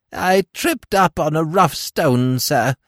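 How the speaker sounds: high, slow, pitiful crone voice; whining old creature. A narrator's reading. Old woman telling Smith she tripped, slow high whine. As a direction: whimper about the fall to win sympathy. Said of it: "whined the old creature".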